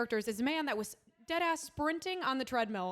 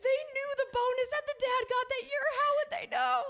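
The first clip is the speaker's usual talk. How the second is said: high, loud, worked-up rant; 4 dB louder than usual. Real, not acted. Friend explaining the ransom note clue, worked-up high rant. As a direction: argue it like the case is personal.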